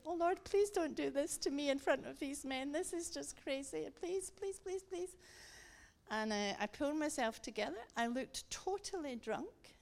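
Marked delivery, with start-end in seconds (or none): 0.1-5.1 tearful pleading. Retold or imitated. Woman begging the Lord in front of these men, tearful pleading. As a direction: retell a helpless, crying prayer. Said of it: "I was crying 'cause I was saying".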